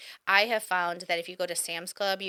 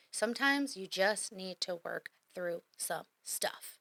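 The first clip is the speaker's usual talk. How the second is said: measured and drawn out, word by word; slow right down to let it sink in; slow, deliberate emphasis; 4 dB quieter, slower than usual. Real, not acted. Coach repeating advice about working through stuff, slowly and deliberately.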